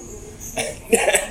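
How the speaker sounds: breathy high-pitched laugh; a burst of laughter at the absurdity. Real, not acted. Telling the friend about the bureaucrat, laughing high. Laughing at his own story.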